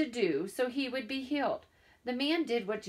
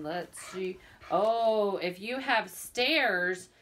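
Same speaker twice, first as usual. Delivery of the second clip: ordinary delivery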